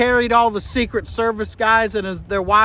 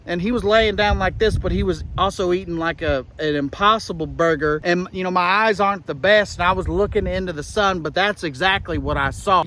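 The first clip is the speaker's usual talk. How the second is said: ordinary delivery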